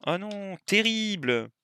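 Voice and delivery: high dismayed groan; oh no, it's ruined. Real, not acted. Juice foams over.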